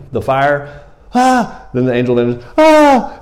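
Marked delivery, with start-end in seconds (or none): 1.1-1.7 high, loud cry of fright; sudden mock-panicked "ah"; freaked-out gasp. Preacher acts Gideon startled by the fire, freaked-out gasp. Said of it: "he's freaking out". 2.6-3.2 loud, very high yelp of fright; freaked-out scream. Acted. Preacher acts Gideon as the angel vanishes, freaked-out scream. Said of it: "he's freaking out".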